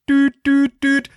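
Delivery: high, loud mock beeps; imitated beep tones. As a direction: beep like the phone line.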